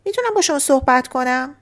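ordinary talk, nothing marked

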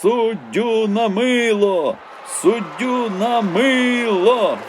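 high, loud, drawn-out chant; crowd yell. A narrator's reading. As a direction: bellow it at the referee. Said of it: "загорлали, надриваючи пупа". (протяжне горлання на весь стадіон)

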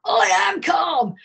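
high, loud "I am calm"; mock indignant shout. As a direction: shout it back, defensive and high, slowed. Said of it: "and I was like".

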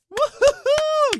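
high sarcastic whoop; mock cheer. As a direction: cheer loudly, dripping with sarcasm. Mock celebration for guessing chef.

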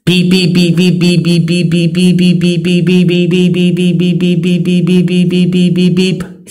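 high, steady monotone beeps; beeping sound. Shown as a demonstration. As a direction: imitate a machine's long run of evenly repeated beeps. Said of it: "show you how the beeping sound goes".

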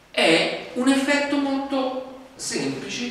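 ordinary delivery

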